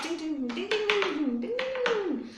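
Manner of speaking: high, rapid tinging patter; rising high ting-ting of quick little feet; running sound effect. Acted. Teacher shows children how a rabbit runs, playful high running sound.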